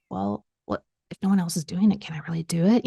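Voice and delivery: whispered self-doubt, asking herself; doubtful whisper. Retold or imitated. Coach doubting her plan, a whispered inner question. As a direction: hush it like a secret fear.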